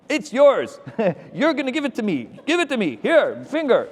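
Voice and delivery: high, forceful mock bride's orders; insistent bride. Bride orders groom to hand over the ring, high insistent imitation. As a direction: imitate a bossy bride cutting off all protest.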